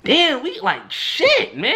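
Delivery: fed up with endless fights; high, fed-up outburst about always fighting; exasperated. Retold or imitated. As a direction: voice shoots high in frustration. Frustrated at another fight.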